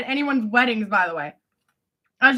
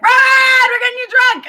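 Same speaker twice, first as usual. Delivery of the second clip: high, loud yell of imitated boys; rowdy boys shouting; 11 semitones higher, 5 dB louder than usual. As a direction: holler it like the lads in the back. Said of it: "The boys in the back would be like".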